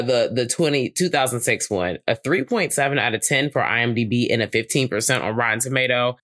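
usual speaking voice

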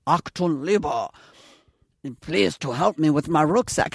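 halting, deliberately bad German accent; half-hearted attempt at a German accent; mock German accent. Actress showing John she can't do it, mock German accent. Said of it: "the German accent?".

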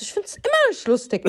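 high, annoyed retort. Retold or imitated. Telling Sunny it isn't funny, high and annoyed. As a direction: refuse to find it funny. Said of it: "Ich so".

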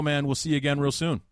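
ordinary talk, nothing marked

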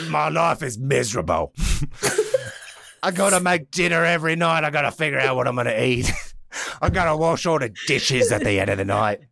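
comic British moaning; British accent. Acted. Twin brother complains about his chores, mock British accent. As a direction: grumble in a put-on British accent. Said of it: "Isukiri has a British".

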